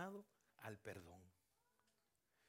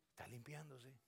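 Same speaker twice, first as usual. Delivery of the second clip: ordinary talk, nothing marked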